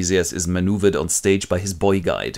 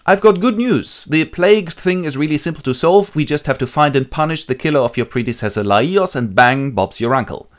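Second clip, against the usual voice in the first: Creon; 7 semitones higher, 4 dB louder than usual. Acted. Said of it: "So Creon enters and goes".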